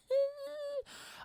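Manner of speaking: high-pitched mock wail; imitated crying. Child crying on the ride, imitated high wailing. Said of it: "I was crying, bro. I was like".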